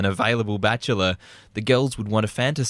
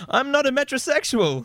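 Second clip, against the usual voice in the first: high indignant denial; 13 semitones higher than usual. Acted. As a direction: voice jumps up high in protest. Repeats denial, pitch jumps.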